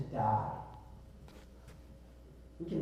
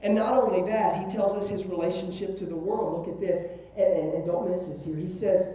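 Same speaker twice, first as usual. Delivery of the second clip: ordinary talk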